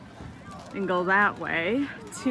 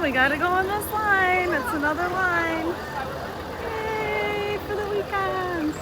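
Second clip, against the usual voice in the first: sarcastic; 10 semitones higher, 3 dB quieter than usual. Real, not acted. Mock cheer at another line.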